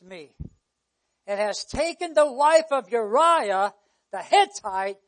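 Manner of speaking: slow, loud scripture proclamation; preaching. Real, not acted. Preacher reading God's judgment to congregation, preaching forcefully. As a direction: proclaim the verse with weighty, drawn-out words.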